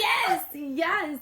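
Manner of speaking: high, loud, delighted yes; excited agreement. Real, not acted. Host agrees that co-host would pull that, excited. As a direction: agree emphatically over the laughter.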